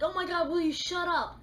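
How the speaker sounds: fed-up yell to shut someone up; exasperated outburst. Retold or imitated. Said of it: "'cause you're just like".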